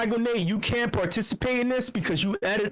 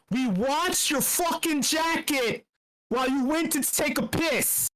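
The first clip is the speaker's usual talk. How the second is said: higher, slow and punchy, leaning on fucking; hyped quote; 7 semitones higher, slower than usual. Shown as a demonstration. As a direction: shout the quote out with relish. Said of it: "which episode?".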